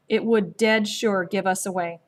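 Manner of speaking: plain reading voice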